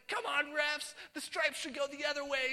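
loud, high armchair heckle; heckling the refs. Retold or imitated. Man watching basketball yelling at refs, indignant.

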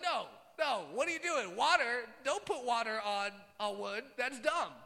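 loud, high mock outrage; incredulous protest. Onlookers seeing water poured on wood, loudly incredulous. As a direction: object loudly as a baffled bystander.

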